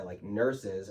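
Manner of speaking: normal talking voice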